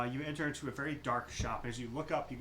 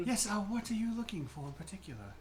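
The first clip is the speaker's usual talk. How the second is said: airy shopkeeper voice; 7 semitones higher than usual. Acted.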